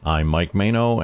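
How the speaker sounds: normal talking voice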